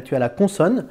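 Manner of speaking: normal talking voice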